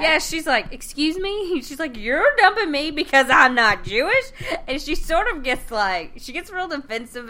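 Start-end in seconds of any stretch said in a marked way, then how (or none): 0.7-4.3 offended Rose. Retold or imitated.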